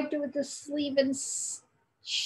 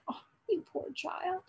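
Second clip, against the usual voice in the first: tender; 5 semitones higher, 9 dB quieter than usual. A narrator's reading. Mother comforting hurt son.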